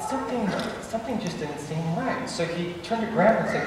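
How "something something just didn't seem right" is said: uneasy hush. Retold or imitated. Grandpa senses danger.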